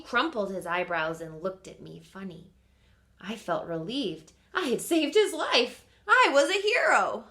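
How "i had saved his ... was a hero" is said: proud hero. Acted. Proud triumphant narration.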